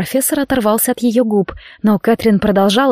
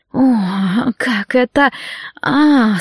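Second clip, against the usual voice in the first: rising moans; much slower than usual. A narrator's reading. Catherine moaning in passion, rising cries. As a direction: moan up into a cry. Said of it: "собственные стоны".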